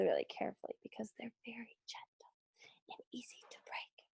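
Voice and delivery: whisper. Acted. Teacher explains the boards are fragile.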